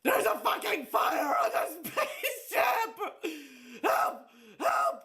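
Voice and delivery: high, drawn-out cry for help; hammed-up panicked shout; mock panic. User tells the ship AI about a fire, mock panic.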